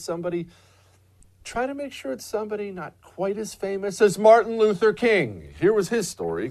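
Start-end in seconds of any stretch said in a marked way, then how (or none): none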